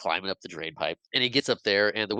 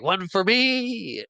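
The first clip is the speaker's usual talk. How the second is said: high, long-held gleeful cry; hammed-up imitation of squealing delight; Toodles's mock glee; 11 semitones higher, 4 dB louder, much slower than usual. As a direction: stretch the last word way up high. Toodles's gleeful turn in retelling.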